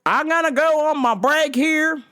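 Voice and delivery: high, drawling waiter impression; put-on server's friendly handover; Kelvin. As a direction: imitate a waiter signing off. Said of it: "Kelvin's like".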